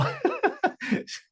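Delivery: airy breathy giggle; soft unvoiced chuckle; light laugh. Real, not acted. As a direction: laugh softly at a kind remark. Laughing at student's comment.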